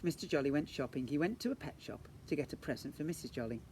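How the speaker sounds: usual speaking voice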